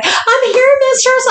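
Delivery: high-pitched imitation of the caller; crossdresser caller. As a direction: put on a high, submissive caller voice. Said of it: "the crossdresser calls me and he's like".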